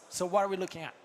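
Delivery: usual speaking voice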